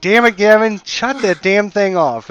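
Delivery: loud, high, furious shout at a noisy friend; angry yell. Acted. Woken sleeper yelling at Gavin over the drumming, furious. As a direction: bellow at Gavin to stop the racket.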